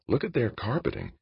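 high, softened near-whisper; hushed aside. A narrator's reading. Emmons remarks to a colleague in the corridor, hushed high aside. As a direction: drop the volume and lift the pitch. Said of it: "Emmons whispered".

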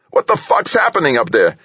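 high, panicky cry over the headset; high-pitched scared scream. A narrator's reading. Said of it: "voice screamed in his headphone, high-pitched, scared".

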